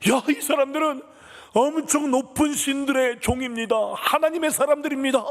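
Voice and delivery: high, loud, excited cry; possessed girl blurting out. Acted. Demon in slave girl announcing Paul and Silas, possessed shouting. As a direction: shout it out high, on one sustained level. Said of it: "이 귀신이 말하는 거야".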